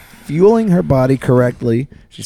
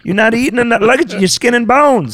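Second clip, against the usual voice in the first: guilt tripping grandma; 7 semitones higher than usual. Acted. Scolding Rita in a put-on voice.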